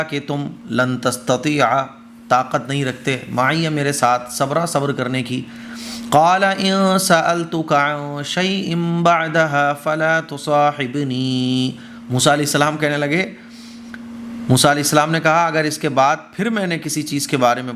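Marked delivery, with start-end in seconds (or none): 6.1-11.7 slow, melodic Arabic recitation; Quran recitation. Acted. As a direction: chant the verse with long held vowels. (دھیمی ترتیل سے تلاوت)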